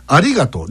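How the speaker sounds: "ありがとう" peaking on り, then falling; deliberate standard-accent "ありがとう"; standard Japanese accent. Shown as a demonstration. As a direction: lift り in "ありがとう" and drop after it. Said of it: "りに行きますね。".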